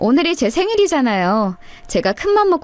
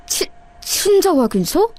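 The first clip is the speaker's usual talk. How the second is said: stunned stammer; 6 semitones higher than usual. Acted. Reads shocking paper.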